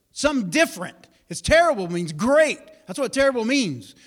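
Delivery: ordinary talk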